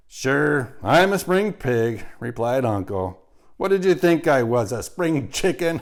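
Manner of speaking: loud, high, brash pig voice; a loudmouth braying his own joke; hearty boast. A narrator's reading. Uncle brags loudly to Charlotte, brash and hearty.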